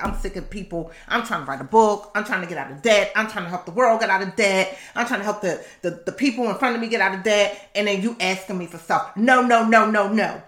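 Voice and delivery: rising exasperated tirade ending in shouted no's; angry rant. Retold or imitated. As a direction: vent it like you're sick of everyone. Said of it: "I was angry 'cause I was like".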